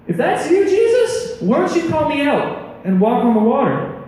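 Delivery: high-pitched call across the water. Acted. Peter calling to Jesus from the boat, high-pitched call. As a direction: call out high, acting an eager disciple.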